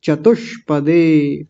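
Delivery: चतुष्पदे slow with a held sh; clear retroflex sh. Shown as a demonstration. Teacher modelling for the class, चतुष्पदे with drawn-out sh. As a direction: stretch चतुष्पदे, holding the retroflex sh. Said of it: "मूर्धन्य शकार की आवाज़ स्पष्ट".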